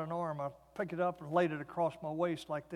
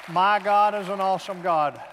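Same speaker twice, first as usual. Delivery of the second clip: louder, ringing declaration of faith; proclaiming; 2 semitones higher, 9 dB louder than usual. Real, not acted. Preacher to congregation, loud triumphant declaration. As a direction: proclaim it to the whole room.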